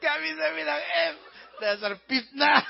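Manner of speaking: hammed-up indignant Batak accent; mock Batak outrage, P in place of F in "fitnah"; angry Batak accent. Batak man rejecting the slander, hammed-up angry Batak accent. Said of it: "Dia marah sekali, dia bilang".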